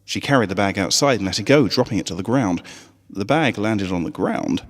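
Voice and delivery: ordinary delivery, nothing marked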